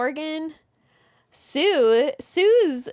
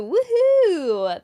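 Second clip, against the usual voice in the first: a bright, loud cheer; excited woohoo; 5 semitones higher, 6 dB louder than usual. Real, not acted. Host cheering a viewer's early delivery, excited. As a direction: celebrate the good news out loud.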